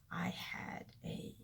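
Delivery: worn-out whisper; breathy exhausted half-whisper; tired whisper. Real, not acted. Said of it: "I'm beat".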